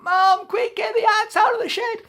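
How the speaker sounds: very high, loud call; high frantic yell. Acted. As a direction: yell for Mum. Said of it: "yelled Jack".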